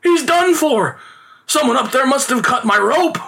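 high-pitched panicked exclamation; theatrical horrified father; alarmed crying out. A narrator's reading. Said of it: "cried the father in alarm".

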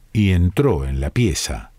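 usual speaking voice

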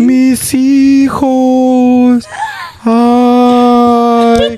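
high, loud, drawn-out ghostly wail; weeping ghost woman. Retold or imitated. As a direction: wail like a mother crying for her lost children. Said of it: "It was like".

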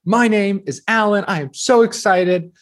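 bright caffeinated game show hopeful; hyped audition intro. Retold or imitated. Contestant greeting casting crew, caffeinated and over-eager. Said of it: "I had a bunch of espresso".

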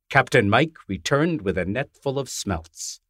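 usual speaking voice

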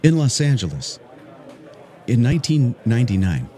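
a lightly done public radio story voice over music; mock NPR storytelling read. Shown as a demonstration. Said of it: "that I can do that N P R thing".

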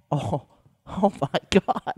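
stifled laugh. Real, not acted. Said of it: "You were laughing before that".

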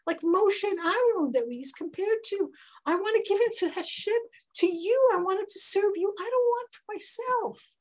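high, loud, fervent pleading; Hannah's impassioned vow. Acted. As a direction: vow it with all your heart. Hannah voiced vowing her son.

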